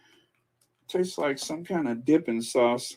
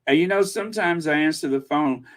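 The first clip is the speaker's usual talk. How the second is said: ordinary talk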